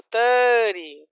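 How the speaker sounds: thirty with THIR loud and drawn out; "thirty", big start then dying away; energy at the start. Shown as a demonstration. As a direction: spend the breath on THIR in "thirty". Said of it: "a energia acaba no começo".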